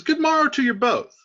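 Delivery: high, loud, hailing voice; proclaimed high and loud; hearty greeting. Acted. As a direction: call out a cheery hello to friends. Reads Romeo's greeting aloud.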